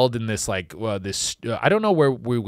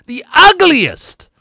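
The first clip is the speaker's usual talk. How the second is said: slow, high, loud "ugliest"; drawn-out emphasis; 14 semitones higher, 10 dB louder, slower than usual. Shown as a demonstration. Comic stressing "ugliest" to the crowd, slow and loud. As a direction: lean hard on "ugliest". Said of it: "emphasis on the winner".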